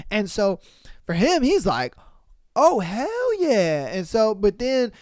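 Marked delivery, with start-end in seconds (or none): 2.6-3.9 high, loud, drawn-out mock glee; imitating an overjoyed boy; thrilled cheer. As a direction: stretch it out in hammed-up triumph. Retold boy thrilled at her confession.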